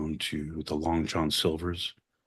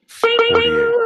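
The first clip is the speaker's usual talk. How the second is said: sudden high loud squeak; squeaked panicked guess; squeaky blurt; 10 dB louder than usual. Acted. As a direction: jump way up on the last number.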